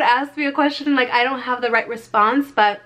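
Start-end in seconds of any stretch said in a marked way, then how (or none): none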